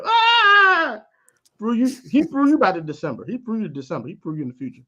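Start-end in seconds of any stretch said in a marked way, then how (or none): 0.0-1.0 high shout as if thrown; a hammed-up yell of being tossed; mock scream. Baker being thrown to the floor, hammed-up scream.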